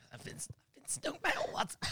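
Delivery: high, breathless, whispered gasp; strangled whisper. Retold or imitated. Stung on the neck before the drive, gasping in a whisper. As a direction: squeak it out as if the neck is swelling.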